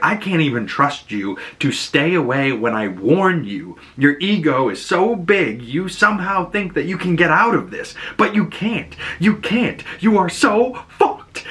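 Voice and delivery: climbs from plain to high, angry retelling; building anger. Retold or imitated. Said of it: "she gets all angry".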